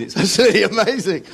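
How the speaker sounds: laughing high and loud. Real, not acted. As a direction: burst out laughing mid-sentence. Laughing at live radio absurdity.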